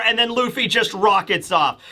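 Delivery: normal talking voice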